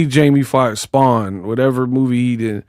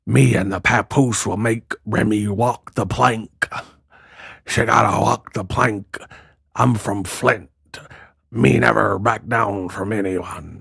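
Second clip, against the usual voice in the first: low, quiet pirate drawl; 4 semitones lower, 5 dB quieter than usual. Retold or imitated. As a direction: talk like a hammy pirate. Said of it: "She talk like a pirate".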